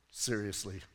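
low, soft, dry disbelief; deadpan disbelief. Retold or imitated. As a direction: mutter it low, unimpressed. Dry inner reaction to the story.

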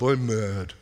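low, sulky mutter, voice dropped; a grown man sulking like a kid; pouting Abraham. Acted. Pastor acting Abraham sulking to God, low sullen mutter. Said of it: "remember, he was pouting".

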